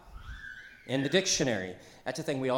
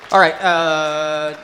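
loud call; 3 semitones higher, 14 dB louder, much slower than usual. Real, not acted. Calling the room to order.

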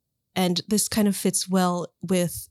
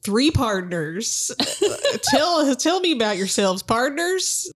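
put-on Southern accent; 3 semitones higher than usual. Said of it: "I have a terrible Southern accent".

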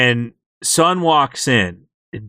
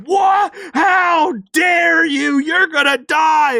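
outraged monster; 13 semitones higher, slower than usual. Retold or imitated. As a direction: scream it at them like an offended monster. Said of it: "this monster's like".

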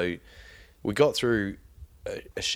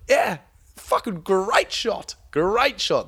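slow, much higher, loud crowing; gloating excitement; 12 semitones higher, 5 dB louder, much slower than usual. Retold or imitated. Mate boasting about his buff bream shot, slow crowing. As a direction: crow about the great shot.

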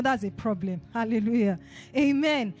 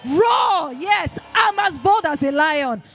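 loud, high, rousing roar; roaring shout; 4 semitones higher, 7 dB louder, much slower than usual. Real, not acted. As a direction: roar it out boldly to rouse the congregation. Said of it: "Can someone roar in the house".

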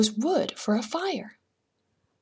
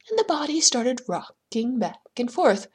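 plain reading voice

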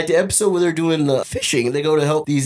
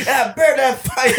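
higher and slow, hammed-up battle cry; mock boxer yell; 8 semitones higher, slower than usual. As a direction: stretch it out like a goofy war cry. Tyson punching Satanists.

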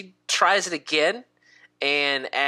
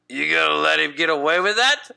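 quick, louder orc voice; goading orc; 5 dB louder, faster than usual. Acted. Orc to his elf friend, loud goading. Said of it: "looks back at his elf friend and goes".

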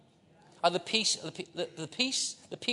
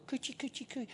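put-on much higher, soft cooing baby talk; 9 semitones higher, 10 dB quieter than usual. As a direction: coo it like tickling a baby. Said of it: "that's Hebrew for".

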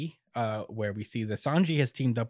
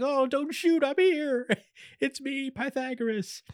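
very high little voice; high squeaky plea not to shoot; small Pythagoras head. Retold or imitated. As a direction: pipe up like a tiny head. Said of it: "head pulls up and is like".

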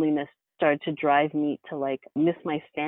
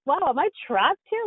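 mock alarmed realization; 10 semitones higher than usual. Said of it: "It's like".

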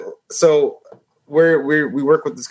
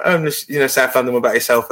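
casual put-on London accent; English accent; faster than usual. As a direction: pass as a South Londoner. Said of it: "with me in English accents".

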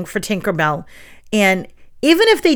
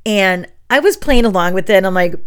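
normal talking voice

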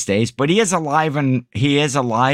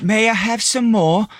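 put-on higher, pleading little-boy voice; little orphan boy; 8 semitones higher than usual. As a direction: beg for more like a small Dickens orphan. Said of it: "It sounded more like Tiny Tim".

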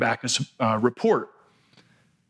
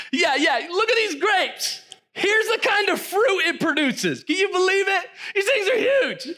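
much higher, laughing in amazement; giddy spies. Retold or imitated. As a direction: gush over the giant grapes. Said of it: "this was their report to Moses".

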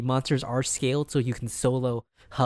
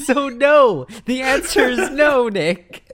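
loud, high exasperated tease; emphatic refusal; 10 semitones higher, 6 dB louder, slower than usual. Real, not acted. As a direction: shut him down loudly, pitched up. Teasing scolding of co-host.

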